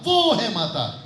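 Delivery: वो stretched and pressed, then falling; stress on वो. Shown as a demonstration. Teacher explaining the writer's meaning, stressed वो. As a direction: press long on वो to single her out. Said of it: "वो कहना चाह रहा था".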